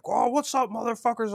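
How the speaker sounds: high, loud mock challenge; imitated confrontational greeting; retold taunt. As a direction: call it out high and cocky, imitating him. Retold guy walks up taunting.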